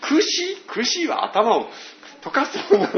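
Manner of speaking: mock a confused classmate asking back; American mishearing. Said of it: "ちょっと". (高くゆっくり戸惑う外国人の声)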